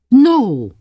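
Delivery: high, loud protest; indignant. A narrator's reading. Drew denies her family would object, indignant. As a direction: burst out in offended denial. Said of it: "said Drew indignantly".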